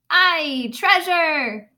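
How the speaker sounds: hearty crew shout. Acted. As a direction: cheer like a greedy crew. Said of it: "the others shouted".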